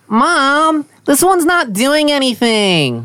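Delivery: put-on high, slow whiny little boy; whiny kid voice. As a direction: whine it like a bratty six-year-old. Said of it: "in a whiny voice".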